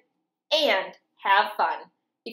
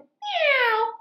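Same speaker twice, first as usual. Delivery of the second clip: high loud meow; cat meow; 4 dB louder than usual. Acted. Reading to kids, the cat meowing high.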